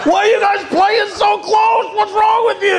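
high-pitched panicked parental yell; yelling at kids. Retold or imitated. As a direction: scold the kids in a shrieking shout. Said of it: "So I yelled at the kids".

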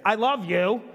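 a put-on, wrong-toned "I love you"; mixed-message I love you. Shown as a demonstration. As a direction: say "I love you" so it sounds off and odd. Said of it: "And I can say".

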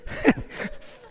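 breathless, high-pitched laugh. Real, not acted. Preacher laughing at his dumpster story, high laugh. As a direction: crack up at the memory.

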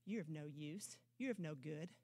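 low, hushed taunting tempter; whispering-close devil mocking the listener; mocker's voice. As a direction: sink low and soft to taunt. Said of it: "when he shows up and says".

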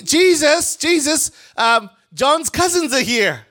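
high, loud eager call; excited call. Acted. Peter calling to Jesus about John's disciples, excited call.

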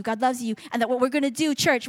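ordinary talk, nothing marked

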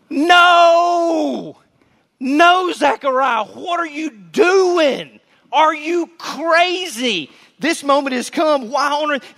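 high, loud pleading shout; collective scream. Pretend. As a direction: yell at him to stop. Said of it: "we all collectively scream".

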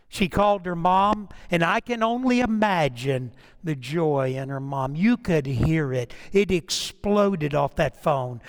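ordinary talk, nothing marked

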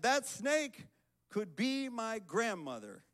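high-pitched retold outcry of reproach; reproachful. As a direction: imitate an alarmed, scolding neighbor. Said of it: "the Buddhist neighbor said reproachfully".

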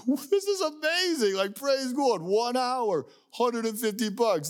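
high, delighted squeal of disbelief; thrilled worker. Retold or imitated. An hour's worker thrilled at the pay, squealing with delight. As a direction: gush like you just got paid a fortune.

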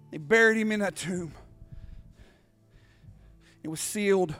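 low, soft, weighty narration; hushed solemn preaching. Real, not acted. Preacher telling the congregation of the crucifixion, hushed and solemn. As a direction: drop to a grave hush with long pauses.

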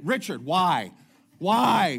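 hammed-up protest at Richard; mock exasperated why. Churchgoer protesting the baby station, mock exasperated pleading. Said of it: "I don't understand it".

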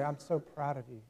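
a soft, tender "so proud" from a father; quietly pleased, as a father to his son; dad telling his boy he is proud, gently; words of delight. Retold or imitated. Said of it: "these words of delight".

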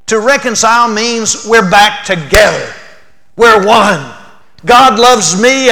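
loud, high preaching on being one with God; full-force preaching. Acted. Preacher telling the congregation they're one with God, loud and fervent. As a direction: preach it out with fervour.

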